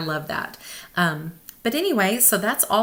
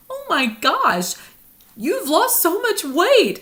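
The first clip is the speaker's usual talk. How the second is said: high, loud, slow exclaim of amazement; amazed brother-in-law; 12 semitones higher, 6 dB louder, slower than usual. Retold or imitated. Brother-in-law stunned by her weight loss, high amazed exclaim. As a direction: gasp it out, stunned by the change. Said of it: "and he was like".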